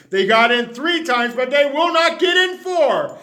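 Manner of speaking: shouting his vow at the bunnies; angry vow. Acted. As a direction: vow it through rising anger. Vowing to keep bunnies out.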